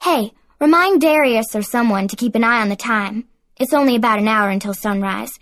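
ordinary delivery, nothing marked

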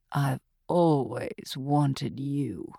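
lightly voiced killer, slow and low; seductive killer. A narrator's reading. Gretchen teasing Archie on the phone, slow and low. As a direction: purr it slowly, a hint of menace.

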